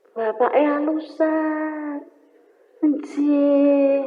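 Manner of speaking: slow, soft-spoken father; lightly voiced mild father, drawn out; gentle father. Lightly voiced gentle father speaking to the family. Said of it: "bapaknya halus banget".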